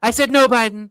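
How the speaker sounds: imitating Trump; Trump impression. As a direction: punch it like a rally line. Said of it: "makes you sound like him".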